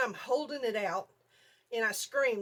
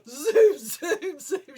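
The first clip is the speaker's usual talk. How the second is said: high, loud zoom noises; excited mimicked zooming; imitated zooming; 6 semitones higher, 11 dB louder, much slower than usual. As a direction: punch out each zoom like a dog tearing past. Imitating the dog racing around.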